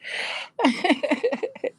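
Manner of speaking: high, wheezy giggle; helpless chuckling at a friend's joke; laugh. Real, not acted. Amused by the viewer's comment.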